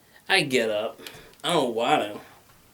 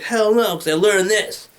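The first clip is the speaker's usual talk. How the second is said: louder defiant retort; defiant refusal; 5 semitones higher, 5 dB louder than usual. Retold or imitated. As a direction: snap back at mom. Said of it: "I was like".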